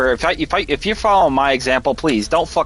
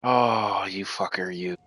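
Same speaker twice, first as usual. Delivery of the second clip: seething under-breath curse, much softer; muttered curse; 3 semitones lower, 6 dB quieter, slower than usual. Real, not acted. Streamer curses the boss under his breath, seething. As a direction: spit it out low and quiet, real fury.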